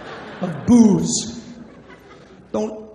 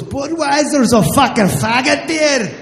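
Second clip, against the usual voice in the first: higher, louder mock Scottish brogue; drunk Scottish bartender; 6 semitones higher, 4 dB louder, slower than usual. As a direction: slur it like a drunk Scottish barman.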